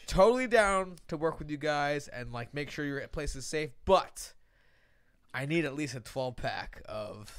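low, softened interview voice; low-key mock negotiation; imitated job candidate. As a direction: play a laid-back applicant stating his terms. Acts Stan at job interview.

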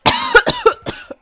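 high, loud coughing fit; choking cough. Real, not acted. Player choked on a piece of granola, choking cough. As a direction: cough as if food went down wrong. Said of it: "I choked on a piece of granola".

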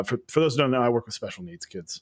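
ordinary talk, nothing marked

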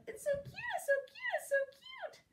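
very high squealy little voice; gushing girls. Acted. Girls admiring a baby rabbit, high gushing squeal. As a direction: coo over it in a tiny high voice. Everyone gushes over baby squirrel.

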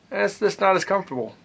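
talking around clenched pipe; pipe in mouth. Real, not acted. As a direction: teeth gripping pipe stem. Seen 1: Pipe stem clenched between teeth throughout the labelled words.

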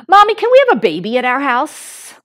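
lightly voiced little boy asking; a hint of a curious toddler; small boy's voice. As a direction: ask it like an eager three-year-old. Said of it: "her three-year-old son".

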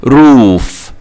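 روف with a long oo; long double O. Shown as a demonstration. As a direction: stretch the vowel in روف. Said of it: "لونج O". (روف بمد الواو)

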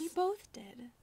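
sheepish whisper; whispered admission. Acted. Maid admitting to the master they sniffed the owners, whispered. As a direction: confess it under your breath.

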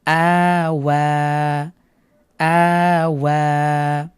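"আ ওয়া" with both vowels held long and slow; stretched syllables. Shown as a demonstration. Teacher reads the joined word for students to copy. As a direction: read it joined, holding each vowel. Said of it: "মিলিয়ে পড়ুন".

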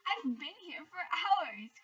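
slow loud call to Hare; cried out. Acted. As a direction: draw it out and call to him. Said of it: "cried Tortoise".

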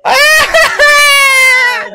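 very high, very loud, slow drawn-out screaming; terrified wail on a falling ride; panicked scream. Real, not acted. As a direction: shriek it like you're falling. Plunging down the drop ride.

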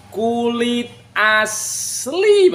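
slow, high drawn-out sales pitch; loud cheerful hawker calls. Real, not acted. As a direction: stretch every word to sell it. Seller hypes the leather.

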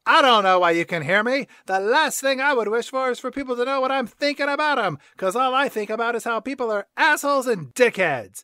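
hammed-up disgruntled cat; Grumpy Cat. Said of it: "Grumpy Cat says".

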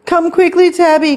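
high, loud alarmed cry; panicked call. A narrator's reading. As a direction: shriek for help, storybook style. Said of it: "called Stanley".

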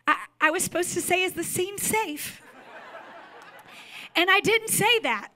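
high-pitched retold apology, half laughing; mock tearful apology. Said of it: "she starts apologizing".

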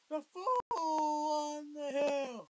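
loud, slowly drawn-out title; shouting title. Real, not acted. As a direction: stretch every word and shout it. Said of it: "And I'm just shouting".

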